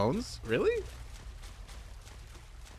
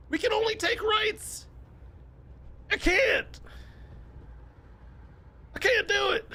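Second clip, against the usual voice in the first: panicked shout. Acted. Streamer panicking while steering in the game, frantic high shouting. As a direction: shout it like you're losing control of the bus.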